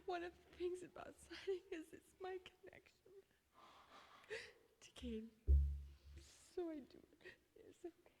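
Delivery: choked up, whispering through tears; crying softly while speaking; tearful whisper. Real, not acted. Grieving mother speaking at memorial.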